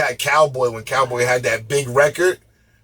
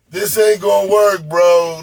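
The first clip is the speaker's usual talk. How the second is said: pushed up and louder, brushing it off; louder dismissive bro voice; 6 semitones higher, 5 dB louder, slower than usual. Retold or imitated. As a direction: say it loud and dismissive, like a bro waving it off. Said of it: "You know what I'm saying?".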